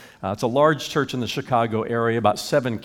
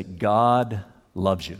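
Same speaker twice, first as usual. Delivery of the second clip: lower, slow, gentle reassurance; slow lowered tender voice; 6 semitones lower, much slower than usual. Retold or imitated. The words people need to hear.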